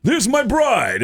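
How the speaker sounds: loud, high stage-comic impression; Rodney Dangerfield. Newlywed comic showing his bride to the club, loud impression. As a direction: belt it out proudly to the room, drawn out. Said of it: "he gets up on stage and goes".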